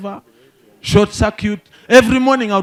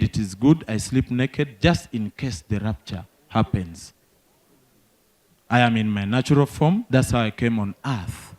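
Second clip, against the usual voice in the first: a lower, softer solemn old uncle, mildly voiced; uncle's solemn voice; 8 semitones lower, 4 dB quieter than usual. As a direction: explain it as holy truth, calmly. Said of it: "He said".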